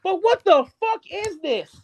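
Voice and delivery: usual speaking voice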